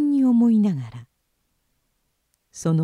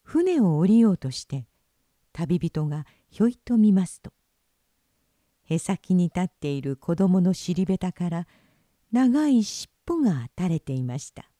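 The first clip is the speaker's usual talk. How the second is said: plain reading voice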